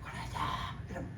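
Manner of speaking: barely audible, voiceless croak; lost voice. Shown as a demonstration. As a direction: imitate talking with a voice that won't come out. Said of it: "대화할 땐 말이 안 나와".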